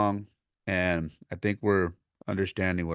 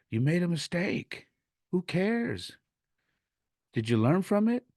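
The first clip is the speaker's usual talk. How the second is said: high, insistent coach pep talk; high-pitched, emphatic consoling; fired-up reassurance; 12 semitones higher than usual. Retold or imitated. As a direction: lift the voice high to buck up an upset player. Retold coach consoling crying player.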